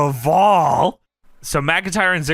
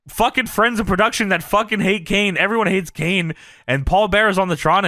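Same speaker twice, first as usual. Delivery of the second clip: usual speaking voice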